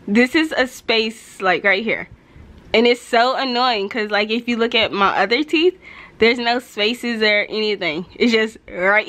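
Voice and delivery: finger pressing on lip; speaking with lip pulled. Real, not acted. Seen 1: fingertip pulls lower lip down to show braces throughout.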